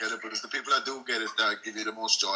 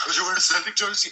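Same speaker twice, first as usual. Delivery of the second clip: mock-loud, higher-pitched girlfriend; mildly raised voice, pitched up; retold loud question; 5 semitones higher, 3 dB louder than usual. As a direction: blurt it out too loudly, imitating her. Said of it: "she said that really loud".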